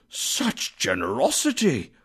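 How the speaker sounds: high, amazed exclamation; breathtaken astonishment. A narrator's reading. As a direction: marvel at an unexpected kindness. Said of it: "as if his breath were taken away".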